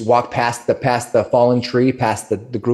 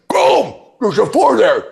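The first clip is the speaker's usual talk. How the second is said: much higher, excited ogre shout; ogre argument; 10 semitones higher, 4 dB louder than usual. Acted. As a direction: triumphantly point out a four. Ogres argue.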